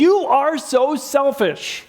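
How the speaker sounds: slow, high, drawn-out accusation; a slow, high attack on the partner; accusing. Shown as a demonstration. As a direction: spit it at them slowly. Acted accusation opening lecture.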